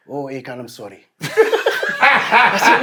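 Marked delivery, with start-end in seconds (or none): 0.1-1.0 soft, hushed apologetic African vendor voice; a hushed, sorry little phone voice; imitating apologetic vendor. Vendor calling [name] to apologize, hushed and sorry.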